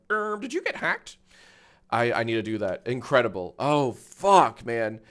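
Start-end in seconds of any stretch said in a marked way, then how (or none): 0.1-1.2 replier. Retold or imitated. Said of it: "twenty replies saying like".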